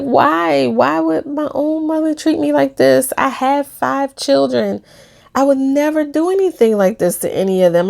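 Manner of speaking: high, tearful pleading through sobs; crying like crazy. Retold or imitated. Telling a friend about her mother, tearful and high. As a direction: weep the words as you protest. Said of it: "I was crying like crazy".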